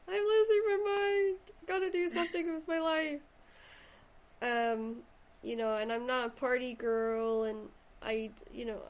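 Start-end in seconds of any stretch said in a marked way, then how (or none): 0.1-3.2 high, loud, desperate voice; frantic younger self. Retold or imitated. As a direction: cry out like you're going stir crazy. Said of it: "one of those ones that were like".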